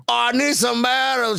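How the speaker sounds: lightly put-on Cajun accent. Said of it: "trying to do the Cajun accent".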